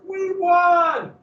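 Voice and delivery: high, loud we won cheer; mock triumphant patriot cheer. Patriots' cheer voiced by teacher.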